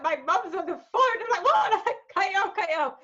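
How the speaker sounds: high-pitched frantic retelling, half laughing; panicked plea. Retold or imitated. Presenter live on air as mum rings, comic panic.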